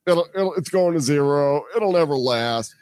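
a hint of a dismissive sceptic; mocking the doubters. Host imitating crypto sceptics, lightly mocking. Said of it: "there's still people out there going".

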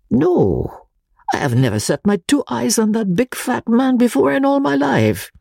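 high, emphatic little professor; Pesca, excited Italian. A narrator's reading. Pesca denies knowing the man, high and emphatic. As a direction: protest it, high and eager.

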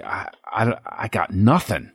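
halting mock stammer; fumbling for words. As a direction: act out grasping for words and giving up. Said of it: "you're fumbling. You're trying to think".

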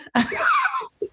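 a big high laugh bursting out. Real, not acted. Laughing at friend's reaction.

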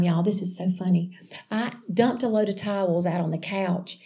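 usual speaking voice

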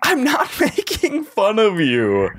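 laughing while denying it. Pretend. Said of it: "why you laughing".

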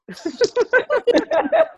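high burst of laughter; loud laugh. Real, not acted. Auntie joke, loud laugh.

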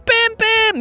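very high, exasperated cry; yelling. Acted. Kai protests the crash through the fence, yelling. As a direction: shout the name in alarm. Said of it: "yelled Kai".

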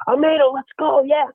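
high-pitched mock cheer; imitating a hyped-up player rooting; imitated energized teammate. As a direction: cheer on the batter, all fired up. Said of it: "energized because of the playoffs".

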